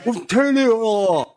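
high, slow, syrupy put-on praise; mock gushing praise. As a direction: drag out the compliments in a high voice.

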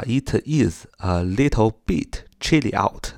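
slow, every word kept separate; unlinked reading. Shown as a demonstration. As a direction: sound out each word without linking. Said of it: "这么读也是可以". (不连读，逐词慢读)

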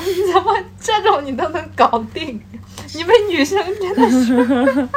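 hoog en luider, ongelovig door lachen heen; verbijsterd lachend. Retold or imitated. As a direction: speel de sprakeloze vriend die lacht. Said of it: "一脸很无语".

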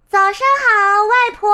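put-on high, loud child's greeting; little girl calling out. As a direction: sing out loudly to someone in the next room. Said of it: "小红帽喊道".

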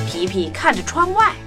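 ordinary delivery, nothing marked

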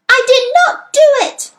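very high, loud scream; piercingly high protest; screamed denial. A narrator's reading. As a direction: scream it in outraged innocence. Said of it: "she screamed".

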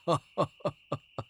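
put-on rapid string of old-man ha-ha-has; quick acted chuckle of an old abbot; old monk's laugh. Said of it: "老和尚笑道".

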